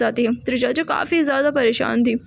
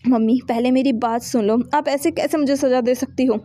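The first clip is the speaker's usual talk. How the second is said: plain reading voice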